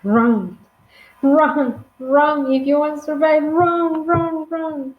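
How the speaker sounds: urgent warning. Acted. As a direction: urge friends to flee for survival. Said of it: "the only thing I'm sure I will say".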